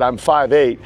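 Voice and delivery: ordinary talk, nothing marked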